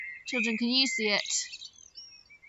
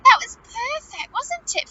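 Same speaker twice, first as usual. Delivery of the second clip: much higher, delighted praise; excited; 11 semitones higher, 8 dB louder than usual. Real, not acted. Teacher delighted by the train's timing, high and excited. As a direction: squeal it with delight.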